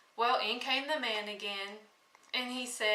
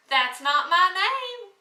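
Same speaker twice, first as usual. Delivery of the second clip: higher, louder gloating little man; little man's taunt; 7 semitones higher, 9 dB louder than usual. Acted. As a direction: crow it back at the queen, pleased with himself. Little man refusing each guess.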